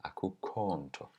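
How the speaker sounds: "Akukho" with its vowel elongated and stressed, whispered. Shown as a demonstration. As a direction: lean on and stretch the vowel of "akukho". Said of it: "This vowel gets elongated and stressed".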